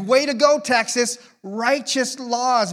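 high, loud mock cheer for Texas. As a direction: cheer like celebrating a win. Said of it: "I was like".

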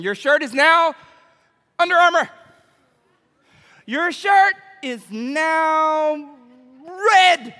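loud, high, stretched-out proclamation; mock booming command. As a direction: proclaim it like a magic decree, drawn out. Mock commanding things into existence.

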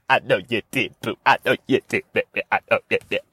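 low, cooing tease; low playful cooing; flirty teasing. Pretend. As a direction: croon it at the audience. Teasing the audience.

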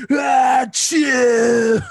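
huge booming fake sneeze; loud sneeze. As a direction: blast out a big sneeze. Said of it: "you could hear me".

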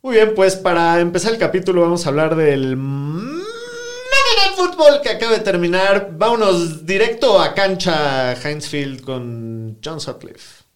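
announcer handing off to the field; broadcast host voice. Acted. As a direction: throw it to the field like TV. Hosting, handing over to the field. (voz de conductor de televisión)

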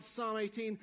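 ordinary talk, nothing marked

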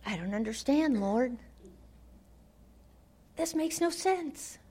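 a hint of helpless confusion; quiet confused lament. Habakkuk's lament to God.

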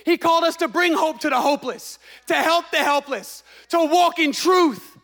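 fervent full-force preacher; preaching. Acted. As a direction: preach it with rising fire. Pastor preaching with rising force.

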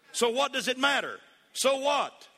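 high, loud, building sermon climax; full-force preaching. Acted. Preacher rallies the congregation, full-force building preaching. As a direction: preach it to the rafters.

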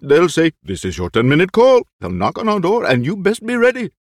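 put-on fussy, anxious old actor; eccentric old actor. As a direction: play the jittery old actor. Lolo waits for his call.

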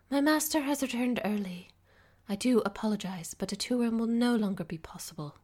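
sorry to turn them away, politely; apologetic housekeeper. A narrator's reading. Housekeeper apologizing to visitors, politely regretful. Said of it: "a note of apology in her tone".

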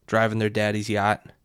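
ordinary talk, nothing marked

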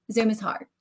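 Zoom is hard in a light country accent. Shown as a demonstration. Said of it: "I'm gonna bring out my accent".

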